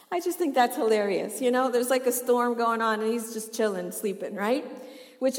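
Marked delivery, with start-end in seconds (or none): none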